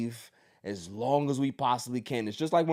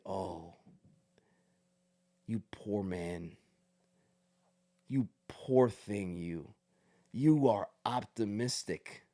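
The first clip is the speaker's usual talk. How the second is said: mock pity; 8 semitones lower, 7 dB quieter, slower than usual.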